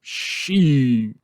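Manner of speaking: mock sheriff. Mimicking the sheriff from the clip.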